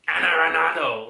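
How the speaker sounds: exaggerated, forceful "anaranjado"; mock demanding. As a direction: belt out "anaranjado" as an over-the-top demanding word. Mocking the demanding word.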